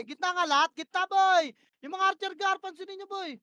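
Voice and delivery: loud, high, urgent calls; yelled commands. Real, not acted. Shotcaller screaming push orders mid-fight. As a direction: bark the push at the squad.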